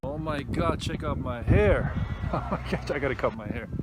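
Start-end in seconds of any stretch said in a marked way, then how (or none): none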